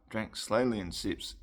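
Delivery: plain reading voice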